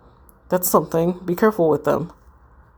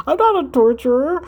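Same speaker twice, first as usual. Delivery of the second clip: much higher, laughing through the words; can't keep a straight face; laughing denial; 7 semitones higher than usual. Real, not acted. [name] protests to the ghosts, laughing high.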